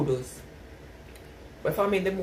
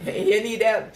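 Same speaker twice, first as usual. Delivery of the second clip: laughing; 3 semitones higher, 5 dB louder, slower than usual. Real, not acted. Laughing at a chatter's comment.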